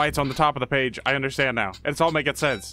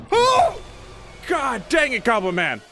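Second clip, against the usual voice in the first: high yelp into exasperated cursing; shriek, then scold in annoyance; startled outburst; 8 semitones higher, 4 dB louder than usual. Real, not acted. Player startled by Goblin Man at the door, exasperated.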